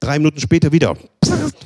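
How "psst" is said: sharp high "psst" poke noise; a quick high hiss for the poke; poke sound "psst". Retold or imitated. Imitating the annoying poke with a sharp high psst.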